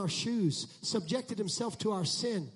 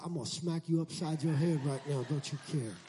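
mock God threat; 6 semitones lower than usual. Preacher playing God scolding complainers, low. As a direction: growl it low like an annoyed God. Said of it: "If I was God, I'd say".

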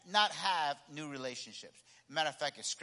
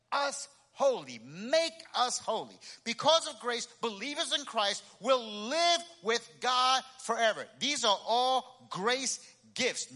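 higher, louder preacher's proclamation; emphatic preaching; 8 semitones higher, 5 dB louder than usual. Acted. Preacher telling the church what grace has given, emphatic and raised. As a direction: drive each phrase home to the congregation. Preaching builds to full force.

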